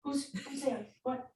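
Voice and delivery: hushed voice for a curious dog; quiet, lightly acted canine curiosity; voicing an alert dog. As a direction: softly play a dog checking who's there. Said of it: "she's like".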